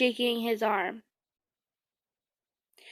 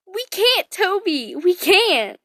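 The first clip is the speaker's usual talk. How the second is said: high, louder pleading; pleading cry; 8 semitones higher, 5 dB louder than usual. A narrator's reading. Lucy begging Toby to stay, high pleading cry. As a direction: beg Toby, voice pitched high. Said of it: "you screamed".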